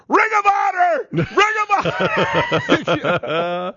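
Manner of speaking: high-pitched fanboy scream; imitated screaming. Imitating his nephew screaming for his favorite kicker, high shout. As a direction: holler it out like an overexcited groupie. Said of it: "he starts screaming".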